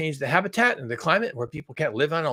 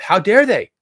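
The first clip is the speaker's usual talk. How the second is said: loud, raised-pitch mock outrage; mock indignation; 4 semitones higher, 9 dB louder, slower than usual. Imitating Paul's attitude to the Chinese, mock indignation. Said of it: "That's his attitude".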